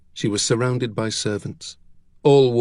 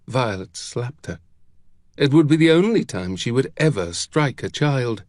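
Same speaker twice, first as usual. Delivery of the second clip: ordinary delivery, nothing marked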